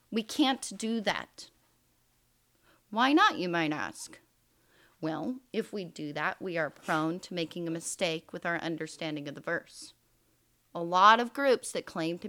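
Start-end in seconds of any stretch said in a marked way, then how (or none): none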